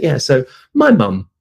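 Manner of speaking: normal talking voice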